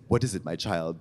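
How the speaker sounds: low, soft kindly priest. Retold or imitated. The priest answering the visitor, low and gentle. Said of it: "he said".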